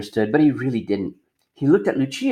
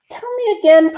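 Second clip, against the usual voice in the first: much higher puzzled small voice; Punchinello voice; 4 dB louder than usual. Acted. Punchinello questioning the mayor's wife, high and puzzled. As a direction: ask like a curious little wooden boy.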